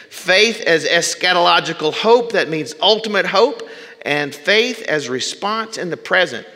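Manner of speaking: fervent pulpit oratory; preaching at full force. Acted. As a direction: preach it to the back pew. Preaching a quoted passage at force.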